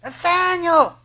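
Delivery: high, loud calling out of the name; long loud high call; scream a name. Retold or imitated. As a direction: shout it across a field. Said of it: "He screamed".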